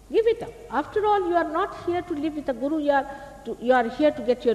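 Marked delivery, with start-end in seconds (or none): 0.1-2.5 much higher and louder, pressing the point; emphatic urging. Real, not acted. Guru urging the hall to drop their doubts, loud and high. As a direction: burst out with it.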